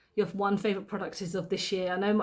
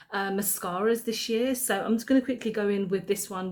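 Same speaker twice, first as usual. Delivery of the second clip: ordinary talk, nothing marked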